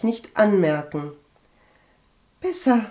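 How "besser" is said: an effortful, higher-pitched single word; laboured answer. A narrator's reading. Sick maid answers Agathe's question, with effort. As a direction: answer as if it takes some effort. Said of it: "antwortete sie mühsam".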